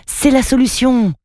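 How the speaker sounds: exclamation bien plus aiguë, pleine d'espoir; lance-le comme une trouvaille soudaine; s'écrie. A narrator's reading. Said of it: "s'écria Takim". («C'est la solution» s'écrie-t-il, beaucoup plus aigu)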